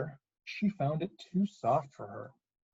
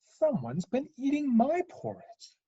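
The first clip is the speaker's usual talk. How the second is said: higher-pitched mother bear at moderate volume; put-out Mama Bear, raised pitch; medium-sized Mama Bear; 9 semitones higher than usual. Acted. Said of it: "in her medium-sized voice".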